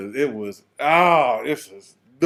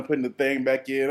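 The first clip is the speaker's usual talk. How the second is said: normal talking voice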